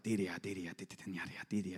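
low, creepy mock chant of syllables; creepy tongues chant. Preacher mimics his mom's tongues in a creepy way. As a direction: chant it like something eerie upstairs. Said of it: "It wasn't all creepy like that".

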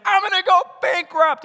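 high, loud comic panic; mock panic. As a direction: blurt it in a panic. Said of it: "You're like".

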